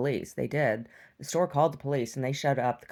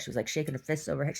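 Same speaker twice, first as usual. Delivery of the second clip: ordinary talk, nothing marked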